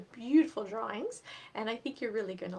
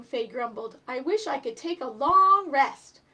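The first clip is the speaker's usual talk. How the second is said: lively, higher storybook reading voice; reading to children; 6 semitones higher, 9 dB louder than usual. A narrator's reading. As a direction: read it aloud to little kids, bright and animated. Reads picture book to children.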